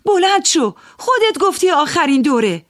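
high, loud scolding; loud angry command. Acted. Woman loses her temper with the gambler, high loud scolding. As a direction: bark at him to get up from the table. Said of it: "با صدای بلند گفتم".

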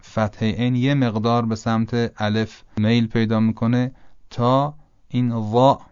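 ordinary talk, nothing marked

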